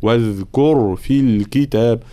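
slow recitation, "واذكر" with a flowing voiced th; correct voiced dhal. Shown as a demonstration. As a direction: chant it, holding the buzz of ذ in "واذكر". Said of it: "وصفة الجهر لكي لا تصير".

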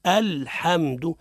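الحمد drawn out slowly, opening hamza sounded; correct recitation demo. Shown as a demonstration. Teacher shows listeners the proper reading, careful slow demonstration. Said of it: "باش نقرأوا الفاتحة صحيحة".

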